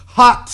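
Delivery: hot. with its T bitten off hard, high and loud; mock-sultry hot. with a crisp final T; stress on the T's. Shown as a demonstration. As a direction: spit out the T in hot. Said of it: "Emphasis on the T's".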